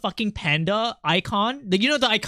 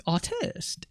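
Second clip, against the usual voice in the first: slightly French accent; 8 dB quieter than usual. Shown as a demonstration. Streamer repeats artist in French style, soft French accent. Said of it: "a French vibe when I was trying to say, like, artist".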